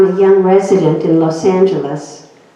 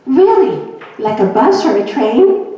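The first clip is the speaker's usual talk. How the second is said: a high, loud joking "Really?"; mock surprise; 7 semitones higher, 3 dB louder than usual. Doctor teasing a patient about her word terminal, mock surprise. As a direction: play up the surprise for a laugh.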